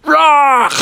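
loud, high animal roar; bear's pouncing cry. Acted. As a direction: roar as you lunge for a fish. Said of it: "and Bubby went".

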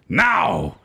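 ordinary talk

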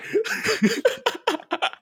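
high-pitched burst of laughter. Real, not acted. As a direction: cackle helplessly. Laughing after the shout.

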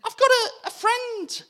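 high, loud eager oh; excited oh. Retold or imitated. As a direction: burst out with delight. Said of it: "I was like".

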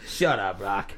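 low, put-on grumpy trainer voice; Mickey. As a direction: dismiss him like an old-school boxing coach, low. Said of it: "That's how Mickey talks".

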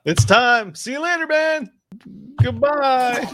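high, cheery little fish waving goodbye; chirpy high farewell as a goldfish; bubbly forgetful goldfish voice. Pretend. Loser flushed down the toilet.

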